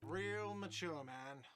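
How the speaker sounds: high, slow, whiny jeer; drawn-out sarcastic whine; whiny shout. Acted. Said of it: "a whiny voice shouts".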